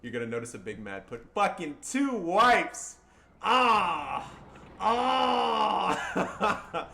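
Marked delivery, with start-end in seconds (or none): 1.3-6.9 high, loud and slow, crying out in delight; victory scream. Real, not acted. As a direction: shout the win out again. Celebrating replay of the wipe.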